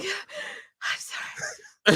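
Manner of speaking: soft, high, very slow squeak; retold crying. Mom apologising to her daughter, sobbing. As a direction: re-enact a choked, tearful apology. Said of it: "And I'm crying and I'm like".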